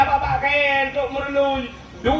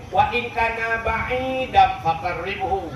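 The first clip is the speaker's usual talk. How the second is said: slow prayer recitation; 4 semitones lower, slower than usual. Acted.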